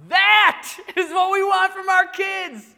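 high, loud, drawn-out exclamation; emphatic preaching. Real, not acted. Preacher telling parents the goal, loud emphatic cry. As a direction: belt out the main point slowly. Preacher drives home the main point.

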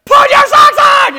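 full-volume, high-pitched furious yell; dad losing it, screaming the order; anger boiling over. Retold or imitated. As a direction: explode at the kids. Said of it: "I'm on the ledge of anger".

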